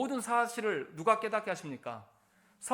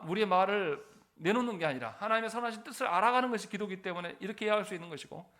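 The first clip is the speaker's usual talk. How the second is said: ordinary delivery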